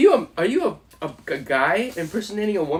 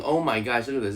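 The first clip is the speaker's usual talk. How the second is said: usual speaking voice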